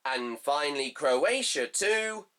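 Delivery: classified-results cadence, rising on home team, falling on away score; football results read. Acted. Vlogger reading the group results to viewers in a results-announcer cadence.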